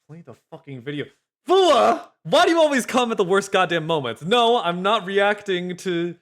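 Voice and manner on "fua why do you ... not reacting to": loud, high-pitched griping; worked-up, frustrated complaint; exasperated outburst. Real, not acted. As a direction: snap loudly at a badly timed interruption. Annoyed by interruption while video stalls.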